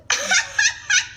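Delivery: high burst of disbelieving laughter; a real high laugh of disbelief; incredulous laugh. Real, not acted. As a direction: laugh out at the absurdity.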